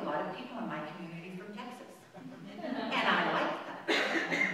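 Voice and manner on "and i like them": laughing admission. Real, not acted. Admits liking Texans.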